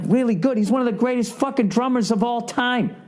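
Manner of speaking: high, emphatic outrage; indignant disbelief. Real, not acted. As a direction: correct her, amazed she undersells him. Indignant correction of coworker.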